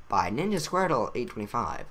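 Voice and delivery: usual speaking voice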